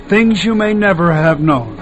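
ordinary talk

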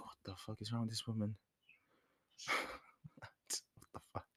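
muttered aside. Retold or imitated. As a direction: drop the voice right down, disbelieving. Aside about the annoying woman.

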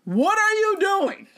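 high, slow, exasperated demand; retelling how she confronted him, high and exasperated; mock exasperated. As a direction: drag it out in disbelief. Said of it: "And I was like".

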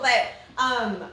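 ordinary delivery

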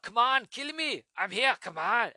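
put-on high, slow, loud Austrian accent taunt; bad Austrian accent daring the enemy to kill; Arnold Schwarzenegger. Taunting the villain like Arnold in Predator, Austrian accent. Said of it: "I'm kinda like Arnold Schwarzenegger".

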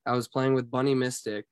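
ordinary delivery

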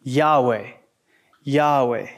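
Yahweh said low as YAH-weh, falling; pronouncing Yahweh. Shown as a demonstration.

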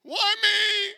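mock wail, high and loud; hammed-up self-pitying cry; self-centred complaint. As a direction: protest like a believer who feels singled out. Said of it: "even a worse question is".

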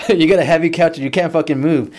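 high, mock dad taunt; heated retold scolding; angry yelling. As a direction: imitate a father yelling down the phone. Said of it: "he's just yelling at me".

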